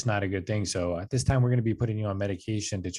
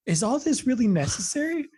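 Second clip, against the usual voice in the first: much higher, louder put-on whine of disbelief; mock incredulous; 5 dB louder than usual. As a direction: play it as an exaggerated, squeaky protest. Mocking his own protest.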